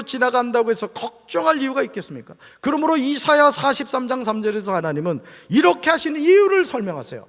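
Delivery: forceful pulpit exhortation; preaching. Real, not acted. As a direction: press the promise on the congregation.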